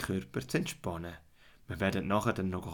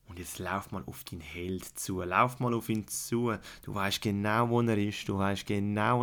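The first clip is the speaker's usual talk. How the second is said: guided fantasy journey. Acted. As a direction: calmly lead a child into relaxation.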